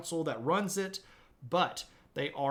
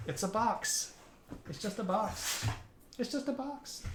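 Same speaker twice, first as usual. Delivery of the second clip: talking to puppy; 7 semitones higher, 4 dB quieter than usual. Real, not acted. Streamer telling the puppy softly it's just a box. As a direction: gently tease the dog, higher each time. Baby talk to dog.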